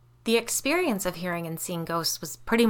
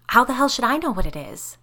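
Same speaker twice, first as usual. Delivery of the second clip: irritated, higher and louder; testy, raised-voice retort; snapped; 6 semitones higher, 5 dB louder than usual. A narrator's reading. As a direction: snap back in exasperation. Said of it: "she snapped".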